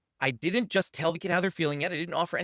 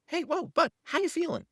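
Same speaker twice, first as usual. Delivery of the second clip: put-on high, gentle check-in to the child; demo to child; 13 semitones higher than usual. Shown as a demonstration. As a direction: ask it lightly, pitched way up. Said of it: "we're just gonna say".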